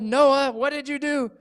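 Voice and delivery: high, loud accusing cry; shocked big sister; scolding sister. Acted. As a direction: catch the little brother red-handed. Sister scolds brother over candles.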